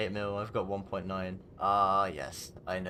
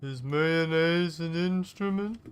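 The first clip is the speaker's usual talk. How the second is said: high, slow, dopey cartoon starfish; Patrick Starfish voice; 7 semitones higher, 5 dB louder, slower than usual. Gamer quoting a cartoon to friends, high slow dopey voice. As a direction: ask it like a clueless cartoon starfish.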